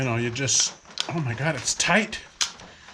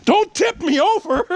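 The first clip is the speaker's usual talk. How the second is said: high, loud protest; startled high-pitched shout; alarmed yell; 6 dB louder than usual. Real, not acted. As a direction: yell as you nearly topple over. Dog nearly knocks him over.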